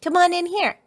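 high, loud call from the garage; bright-pitched child's holler. A narrator's reading. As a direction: call a friend in from a distance. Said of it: "called Lizzie from the garage".

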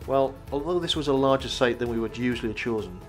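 ordinary talk, nothing marked

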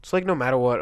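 normal talking voice

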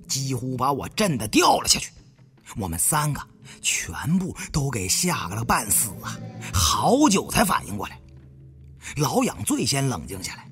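ordinary delivery

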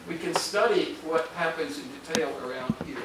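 ordinary talk

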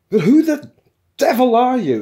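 very high, loud outrage; outraged exclamation. A narrator's reading. Programmer confronting the raving intruders, outraged. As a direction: explode with indignant disbelief. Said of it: "exclaimed outraged Fuke".

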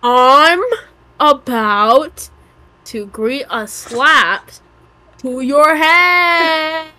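slow. Acted. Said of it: "slow drawn-out threat".

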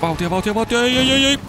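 high, loud cries of speed, then no no; rising frantic shout at the race; excited urging yell. Real, not acted. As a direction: cheer the car on, then panic as it slips. Urging car on, then losing.

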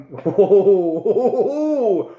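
loud, high "whoo" rising and falling; long whoops as the burn hits; whooping at the heat. Real, not acted. Reviewer hit by chilli heat, whooping.

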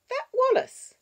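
put-on high, loud little-girl voice; questioning child. Six-year-old asking about the budgie man, questioning child voice. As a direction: ask it like a small child.

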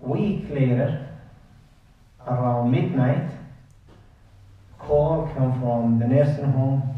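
plain reading voice

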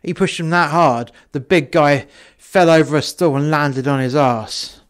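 ordinary delivery, nothing marked

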